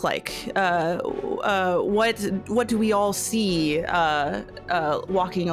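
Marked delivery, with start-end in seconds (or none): none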